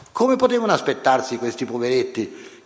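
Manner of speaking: ordinary delivery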